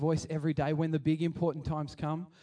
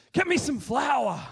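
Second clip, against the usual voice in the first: higher and louder, slow firm command; commanding order; 9 semitones higher, 6 dB louder, slower than usual. Retold or imitated. Elisha ordering the people to bring flour, commanding.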